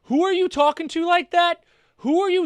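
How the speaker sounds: high-pitched imitation of an affronted officer; mock indignation. Reactor voices blonde officer confronting the person filming, mock indignant. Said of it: "she walked up like".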